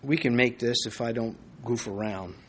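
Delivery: usual speaking voice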